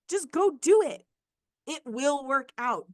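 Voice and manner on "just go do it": excited urging. Real, not acted. Pep talk to listeners.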